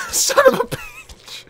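very high, drawn-out laughing squeal; helpless laughter. Real, not acted. Player at a casually standing enemy, helpless laughter. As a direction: squeeze the words out slowly through laughter.

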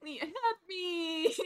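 drawn-out whimpering plea; mock wailing. Streamer begging customers to leave them alone, mock wailing. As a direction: wail for help in hammed-up despair. Overwhelmed, begs for help.

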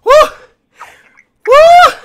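hyped shouting. Real, not acted. Celebrating riches.

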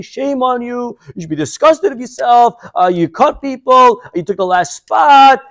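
high, mock-indignant scolding; shrieked-up scolding, hammed up with drawn-out endings; retold yelling. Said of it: "She starts yelling in front of everybody".

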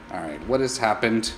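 ordinary talk, nothing marked

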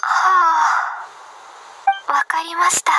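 breathy, resigned whisper; whispered sigh. Acted. Resigned agreement after scolding.

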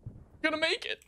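rising, straining hope; hopeful high rise. Real, not acted. Tense survival in game.

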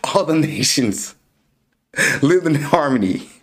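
dramatic narrator voice. Pretend. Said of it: "I'm, I'm playing".

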